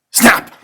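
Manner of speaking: loud sharp snap of jaws; mock jaws snapping shut. A narrator's reading. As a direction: bite the word off hard.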